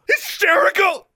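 very high, loud hysterical shriek; screamed. A narrator's reading. Daughter throwing her father's word back, screaming. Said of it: "she screamed".

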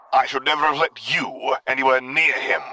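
a dark lord rebuking his son; menacing imperial voice; Vader. A narrator's reading. Vader voice held by narrator.

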